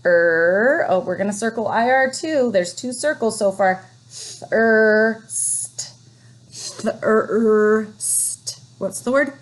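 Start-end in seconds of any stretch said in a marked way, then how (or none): 4.1-8.6 thirst stretched out sound by sound; segmenting thirst for the class. Shown as a demonstration. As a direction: drag out each sound of thirst slowly. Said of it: "teacher sounding out the word".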